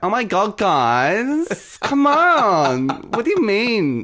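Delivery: lightly camp, high and sing-song. Shown as a demonstration. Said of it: "You can do a camp voice".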